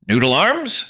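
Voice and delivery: high, loud indignant echo; offended repeat. Acted. Narrator repeating the insult, indignant. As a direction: throw the name back in disbelief.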